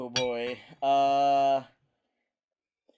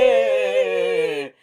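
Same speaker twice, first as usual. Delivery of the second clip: behind welding mask; 5 semitones higher, 7 dB louder than usual. Real, not acted. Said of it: "You can't tell with this mask on".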